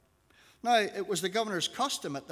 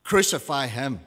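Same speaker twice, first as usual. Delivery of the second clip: firm, louder crowd reply; crowd's answer; 2 semitones lower, 8 dB louder than usual. A narrator's reading. Crowd answers Pilate's question, loud and firm. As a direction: answer loudly as one crowd, without a full shout. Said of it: "They all answered".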